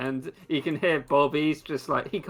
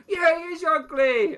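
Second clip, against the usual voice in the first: high-pitched acted yell; high, eager heckling voice; crowd heckle; 14 semitones higher, 4 dB louder than usual. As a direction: chime in loudly from the crowd. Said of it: "right sharp".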